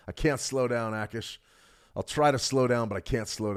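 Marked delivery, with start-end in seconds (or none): none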